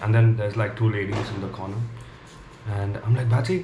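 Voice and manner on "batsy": questioning call. Retold or imitated. Calling the cat questioningly.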